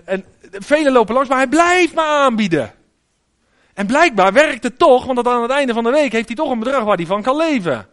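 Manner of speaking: ordinary delivery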